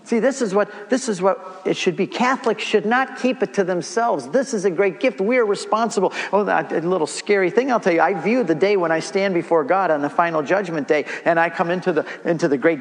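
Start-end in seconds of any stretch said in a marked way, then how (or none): none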